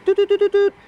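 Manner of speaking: high, loud beeping noises done with the voice; mock alert beeps. Guest mimics the radio's weather alarm for the host, loud high beeps. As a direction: beep out each doot like a warning tone.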